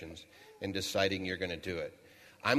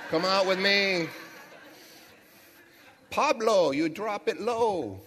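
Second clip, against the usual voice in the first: put-on party-boy tease; 11 semitones higher, 7 dB louder, slower than usual. Bruno teasing Pablo to come party, high laughing call. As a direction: lure him out like a club-goer. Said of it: "Bruno was like".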